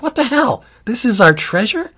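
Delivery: high-pitched disbelieving question; incredulous dismay. Acted. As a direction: squeak it out in mock outrage. Orcs finding only acorns.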